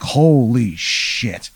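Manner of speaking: slow, stretched "holy shit" in awe; awed whisper-like gasp. Acted. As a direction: savor the shock. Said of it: "So you're like".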